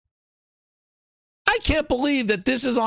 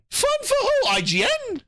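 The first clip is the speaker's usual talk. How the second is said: much higher, disbelieving "for who?"; incredulous; 13 semitones higher than usual. Real, not acted. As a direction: scoff at it, voice jumping way up. Scoffing at the quoted claim.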